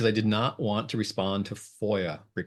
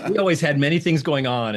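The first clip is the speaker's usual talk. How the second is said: laughing through the words, louder; 7 semitones higher, 7 dB louder than usual. Real, not acted. Telling of his busy supervisor, laughing. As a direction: chuckle out the line.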